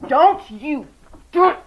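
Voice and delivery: sharp loud warning; scolding the dog. Real, not acted. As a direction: cut the puppy off mid-bark. Scolding the puppy.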